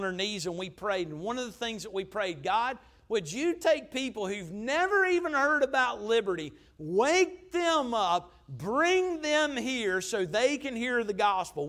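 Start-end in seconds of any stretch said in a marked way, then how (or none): 2.4-11.7 raised, slowed pleading prayer to God; fervent prayer. Retold or imitated. Pastor voicing the church's prayer, raised and pleading. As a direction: cry out to God for the lost.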